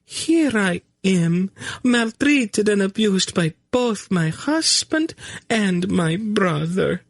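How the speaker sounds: high, slow, hammed-up tragic lament; milk the mistreatment in a high trembling voice; artfully tragic. A narrator's reading. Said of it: "her expression became artfully tragic".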